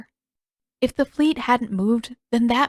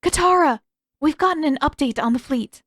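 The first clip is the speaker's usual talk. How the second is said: called across the yard, loud and high; calling out to Katara across the practice yard; 6 semitones higher, 4 dB louder than usual. A narrator's reading. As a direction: call her name from a distance. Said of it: "Yue called out".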